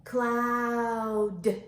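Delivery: CLOUD stretched long on a level tone, D released at the end; slow-motion Cloud with a detached D; said slowly. Shown as a demonstration. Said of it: "let's say it slowly".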